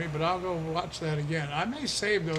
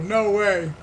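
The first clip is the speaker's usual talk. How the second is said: loud surprised; 4 semitones higher, 10 dB louder than usual. Real, not acted. Reacting to town name Fertile.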